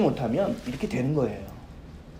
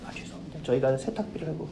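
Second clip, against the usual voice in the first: whispered, much softer apology; hushed apology; 3 semitones lower, 10 dB quieter than usual. Retold or imitated. Manager rushing over whispers an apology to the angry customer. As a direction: grovel under your breath to calm her.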